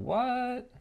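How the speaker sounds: high startled squeak; surprised what. Real, not acted. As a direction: shoot up in disbelief. Opponent swings in unexpectedly.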